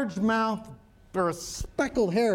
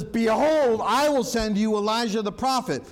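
scripture reading; 4 semitones higher than usual. Acted. Rereads the verse.

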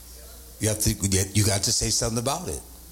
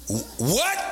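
mock upset question with a steep rise; voice going up; 5 semitones higher than usual. Preacher acting out being upset as the head shifts, voice going up. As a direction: stammer into a high, challenging question. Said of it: "my voice goes up".

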